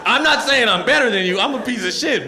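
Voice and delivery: a loud laughing defence; defensive. Retold or imitated. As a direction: protest it with a laugh.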